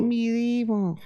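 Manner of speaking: high alien voice; little alien voice. Pretend. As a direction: squeak Medieval like a tiny alien. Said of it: "Sounds like a little alien".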